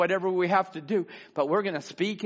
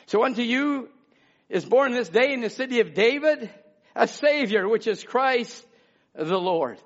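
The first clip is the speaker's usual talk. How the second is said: raised, high preacher's reading; ringing pulpit recitation; scripture proclamation; 7 semitones higher, 4 dB louder than usual. Real, not acted. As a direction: declare the verse to the congregation.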